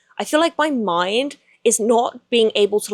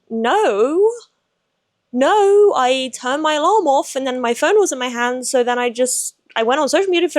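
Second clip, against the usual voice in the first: defensive protest; 5 semitones higher than usual. Retold or imitated. Defending herself to her friend.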